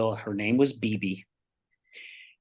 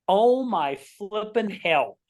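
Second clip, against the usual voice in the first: slow, drawn-out high exclamation; stunned disbelief; 7 semitones higher, slower than usual. Retold or imitated. Luncheon winner gets the same author's book, stunned disbelief. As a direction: stretch it out in amazed exasperation.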